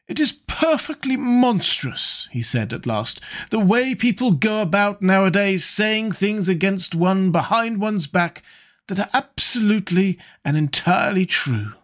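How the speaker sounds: high, languid lord; drawling wit. A narrator's reading. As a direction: toss off the quip like a bored lord. Lord Henry's epigram.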